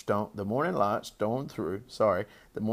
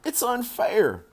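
put-on high, loud childish wail; tearful high-pitched complaint; sobbed protest; 14 semitones higher, 5 dB louder, slower than usual. A narrator's reading. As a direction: wail it as a distraught little girl. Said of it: "she sobbed".

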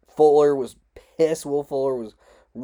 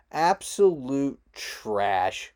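slow disgust; much slower than usual. Real, not acted. Ranting disgust at the NFL.

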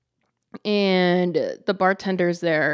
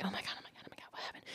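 imitated frantic whispering; hushed, breath-only gossip voice; excited whisper; 22 dB quieter, much faster than usual. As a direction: whisper it in a rush like an eager coworker. Voicing the host's hushed excitement.

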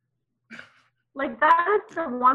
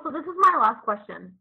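normal talking voice

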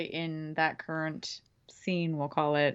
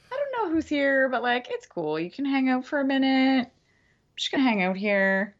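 high, breezy mock-chill voice to a ghost; tipsy casual brush-off; 8 semitones higher, 4 dB louder than usual. To the ghost, pretending to be cool. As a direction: act blasé while half buzzed. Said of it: "I'd probably be like".

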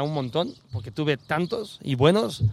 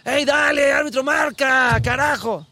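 high, loud mock shout at the referee; hammed-up touchline yell; retold yelling; 9 semitones higher, 4 dB louder, slower than usual. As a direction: heckle the referee at the top of your voice. Acts himself yelling at referee.

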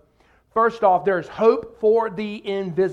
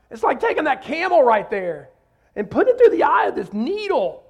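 high, exaggerated sarcastic comparison; sarcastic, animated mock suggestion; sarcasm; 5 semitones higher, 5 dB louder than usual. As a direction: play up the absurdity, climbing in pitch. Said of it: "doesn't have sarcasm".

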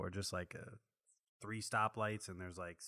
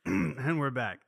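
slow, loud host return; announcer voice; 5 semitones higher, 8 dB louder, slower than usual. Pretend. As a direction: announce it like radio. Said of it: "clears throat, back on air".

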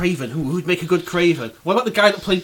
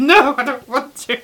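mock Norman whine; 10 semitones higher, 7 dB louder than usual. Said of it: "Norman refusing".

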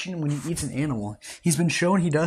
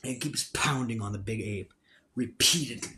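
story narration; 6 dB quieter than usual. Acted. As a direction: tell the fight like a story. Narrates the battle scene.

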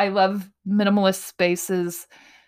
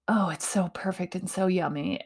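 whisper; 6 dB quieter than usual. Real, not acted. Gushing over dream bed.

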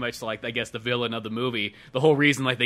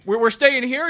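high, loud dismayed question; mock dismay; 11 semitones higher, 5 dB louder than usual. Friend voicing the cop at the ramshackle hotel, dismayed. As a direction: sputter it in disbelief. Retelling movie dialogue.

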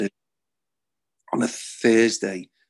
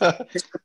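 bursting out laughing mid-story; a quick high laugh; real laugh; 8 semitones higher than usual. Real, not acted. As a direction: laugh as you retell the chase. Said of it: "I was laughing".

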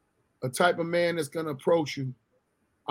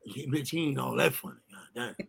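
lower, softer side-comment; muttered mock dismissal; 8 semitones lower, 9 dB quieter than usual. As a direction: sneer it under your breath. Girlfriend mocking the comedian.

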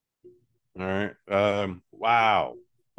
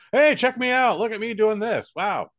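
high, loud showman's call; hammed-up busker, pitched way up; street performer; 12 semitones higher, 5 dB louder than usual. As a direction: call out for a crowd's attention. Voices a street performer touting tricks.

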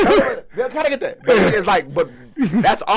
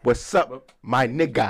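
stiff, spaced-out copy of slang; imitated awkward greeting; 8 semitones lower, 4 dB quieter, slower than usual. Retelling Jackie trying to act cool, awkward. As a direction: say it carefully like a newcomer copying slang.

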